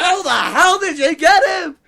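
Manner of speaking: high, loud disbelieving outburst; incredulous shout. Real, not acted. Co-host demanding to know how the guest was booked, incredulous shout. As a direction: shout it in laughing amazement.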